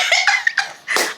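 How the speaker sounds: high unvoiced giggle; high breathy laugh. Real, not acted. Laughing to viewers about the argument, high giggle. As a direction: let a wheezy giggle out. Laughing at her own taunt.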